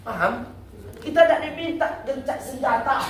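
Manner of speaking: ordinary talk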